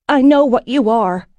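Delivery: sudden, high and loud; burst out. A narrator's reading. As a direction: blurt it out as a last card. Heroine plays her final card.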